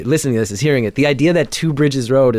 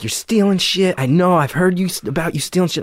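higher, accusing mock shout; imitated yelling; 6 semitones higher than usual. Driver accuses narrator of stealing, high mock yell. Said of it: "this guy's yelling at me".

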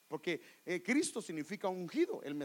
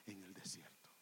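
whispered ending, almost gone; 12 semitones lower, 19 dB quieter than usual. Acted. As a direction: drop to a bare breath. Preacher prays, voice fading. (susurro casi inaudible)

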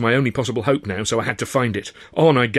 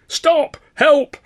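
very high, loud cry for help; guard shouting after the runaways; 8 dB louder than usual. A narrator's reading. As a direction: cry out as if under attack. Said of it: "I heard Mike shouting".

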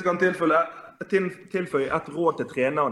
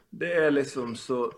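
usual speaking voice

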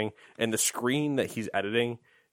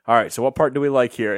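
brisk loud question; 7 dB louder, faster than usual. Retold or imitated. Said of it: "And they're like".

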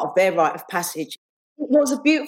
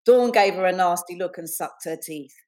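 ordinary talk

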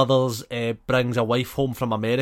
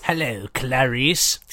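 slow, creepy Hannibal Lecter; a hint of a slow sinister Hannibal; slower than usual. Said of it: "What does he do in Hannibal".

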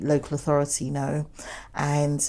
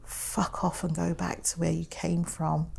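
whispering; 7 dB quieter than usual. Retold or imitated. Retelling a hostile insult.

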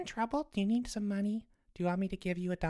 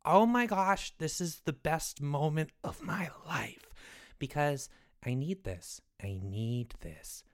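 retold reaction; 4 semitones lower, slower than usual. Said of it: "I was like".

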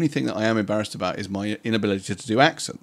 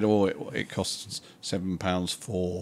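put-on northern accent; 4 dB quieter than usual. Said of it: "You're gradually leaning into an accent".

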